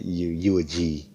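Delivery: usual speaking voice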